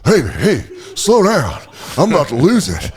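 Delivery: a hint of the flustered bum; mock-nervous homeless man voice; homeless guy voice. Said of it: "We're going like".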